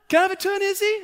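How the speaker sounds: put-on very high, loud kid voice; squeaky imitated child begging for a go; pleading little boy. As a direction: beg like an eager four-year-old. Dad voices son begging.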